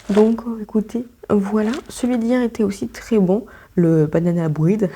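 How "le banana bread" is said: "banana bread" said slowly in a put-on English accent; mock English accent. Shown as a demonstration. Vlogger jokes about her English, saying "banana bread" in a mock English accent. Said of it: "Avec mon super accent".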